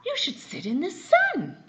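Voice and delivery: high, loud animal voice; a sunny creature calling out high. A narrator's reading. Father reading to child, high loud animal voice. Odd animal line read aloud.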